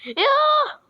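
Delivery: sudden high, loud cry; a startled shout; high, loud startled yelp as the game freezes; surprised yelp. Real, not acted.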